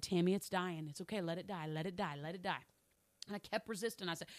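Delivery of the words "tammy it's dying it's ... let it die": soft and lower, calmly reassuring; a hint of the Lord soothing her, let it die; gentle voice of God. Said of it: "And the Lord said".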